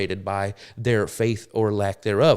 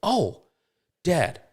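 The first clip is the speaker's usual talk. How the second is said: higher-pitched mock child's plea; imitated little boy; 8 semitones higher than usual. Little boy excusing himself to Dad, imitated in a higher voice. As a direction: raise the pitch like a boy appealing to his father. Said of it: "my little boy goes".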